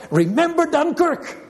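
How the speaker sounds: high rallying shout of a battle cry; a war cry, ringing high. Retold or imitated. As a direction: call it out like soldiers rallying. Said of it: "they would cry out".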